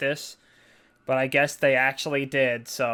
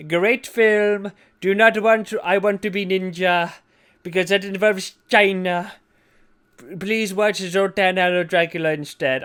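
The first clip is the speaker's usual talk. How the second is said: lightly voiced Trump, higher and louder; Donald Trump impression; 5 semitones higher, 5 dB louder than usual. Telling how he fired Jennifer, mock Trump voice. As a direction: keep the lazy Trump bit going. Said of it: "every other Donald Trump bit".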